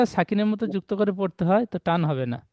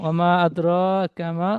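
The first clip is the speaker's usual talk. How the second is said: Quran recitation; much slower than usual. Shown as a demonstration. Teacher recites the next verse.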